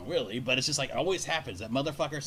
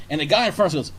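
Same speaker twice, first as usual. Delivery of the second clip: ordinary delivery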